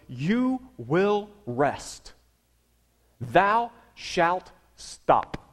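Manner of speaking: very slow, each word spaced out; solemn commandment. Acted. Preacher voicing God's command to the Israelites, very slow and solemn. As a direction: lay down the law slowly and gravely.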